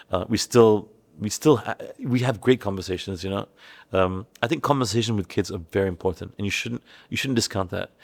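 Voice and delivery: ordinary talk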